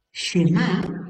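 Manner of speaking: Shema with the stress landing on the final A; Shema pronounced. Shown as a demonstration. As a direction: lean on the A in Shema, SHEH-MAH. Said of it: "y acento en la A".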